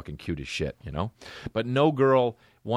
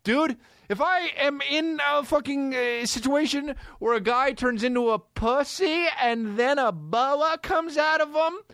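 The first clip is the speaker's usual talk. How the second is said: excited. Real, not acted. Excited rant.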